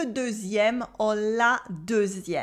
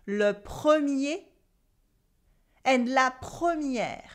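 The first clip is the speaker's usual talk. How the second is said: le premier, slowed right down; slow repeat; 3 semitones higher than usual. Shown as a demonstration. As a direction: drag out le premier for learners. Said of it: "repeat after me".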